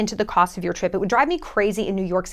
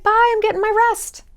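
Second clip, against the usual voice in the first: higher, airy brush-off; breezy goodbye; 11 semitones higher than usual. Retold or imitated. Turning down a stranger, higher breezy goodbye. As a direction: wave them off lightly in a high voice. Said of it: "it's like".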